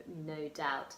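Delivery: usual speaking voice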